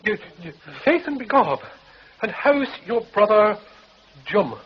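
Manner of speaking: high, comic stage Irish voice; music-hall Irishman, hammed up; stage Irish accent. Comic Irish act feed line.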